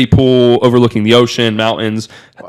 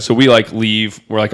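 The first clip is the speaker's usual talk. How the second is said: usual speaking voice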